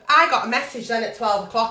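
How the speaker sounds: ordinary delivery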